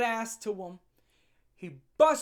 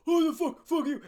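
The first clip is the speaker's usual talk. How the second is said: imitating a cursing guy, higher and louder; mock cursing; 8 semitones higher, 5 dB louder than usual. Vlogger imitating the guy cursing out the man. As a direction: mimic a hothead swearing at someone. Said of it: "You don't".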